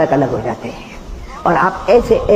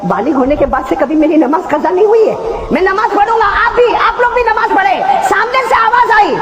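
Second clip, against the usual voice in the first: impassioned preaching; 12 semitones higher than usual. Acted. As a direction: preach it to the crowd. Preaching Husain's words.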